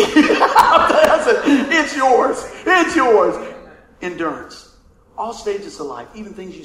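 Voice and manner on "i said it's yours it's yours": laughing. Real, not acted. Emotional handing over belt.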